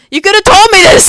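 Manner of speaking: real burst of shouting at an absent friend; sudden outburst. Real, not acted. Venting at a friend who left, shouting.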